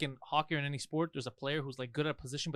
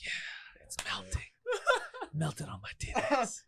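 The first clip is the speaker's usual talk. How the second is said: mock sensual whisper; 7 semitones higher, 3 dB quieter, much slower than usual. Mock sensual food moaning.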